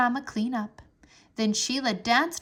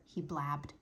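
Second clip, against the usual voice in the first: usual speaking voice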